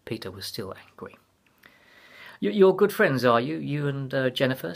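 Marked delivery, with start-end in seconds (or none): 2.4-4.7 a hurried, higher question to change the subject. A narrator's reading. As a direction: rush in to steer away. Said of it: "said Tamsin hurriedly".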